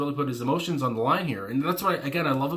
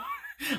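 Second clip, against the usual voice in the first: sudden high giggle; real giggle at the lyric; high burst of laughter; 7 dB quieter than usual. Real, not acted.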